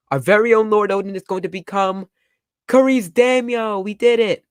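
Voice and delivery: high, loud, elated retainers; acted jubilant cheer. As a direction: burst out in celebration. Acting out Oden's celebrating followers.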